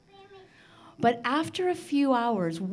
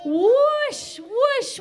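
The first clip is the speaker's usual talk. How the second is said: high, loud whoosh of the wind; 14 semitones higher, 6 dB louder than usual. Acted. Storyteller voicing the wind scattering feathers, loud whoosh. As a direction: blow it out like a gust through the room.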